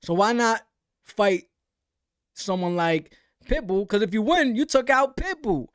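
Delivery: ordinary talk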